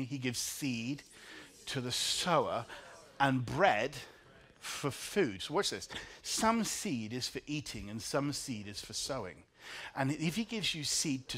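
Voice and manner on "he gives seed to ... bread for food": slow, each phrase spaced; slow call-and-response. Shown as a demonstration. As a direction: lead the congregation, phrase by phrase. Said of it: "Will you say that with me".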